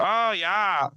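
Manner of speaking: mock German yes. Imitating the German couple.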